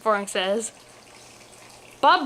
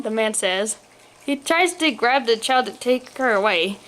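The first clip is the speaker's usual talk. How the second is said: usual speaking voice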